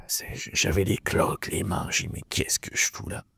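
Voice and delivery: worn-out whisper to himself; despairing whisper. Retold or imitated. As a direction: breathe it out as if at the end of your strength. Remembers despair at his work.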